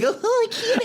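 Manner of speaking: very high, squeaky imitation of fretting; mock panic. Past self hiding love of anime from peers, mock panic. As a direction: play up the social dread in a tiny high voice.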